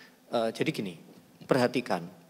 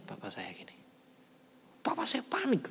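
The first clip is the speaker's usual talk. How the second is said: breathy hushed whisper, all air; 11 semitones higher, 8 dB quieter than usual. Retold or imitated. Son recalls staring down his father, whispered. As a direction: hush the words as if the father could hear.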